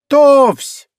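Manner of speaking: loud, high drill command; ringing shout. A narrator's reading. Girl commanding a snowball volley, ringing shout. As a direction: call out the order at high pitch. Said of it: "девочка звонко прокричала".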